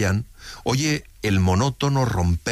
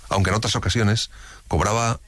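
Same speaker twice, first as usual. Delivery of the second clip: ordinary delivery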